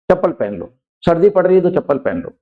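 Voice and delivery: usual speaking voice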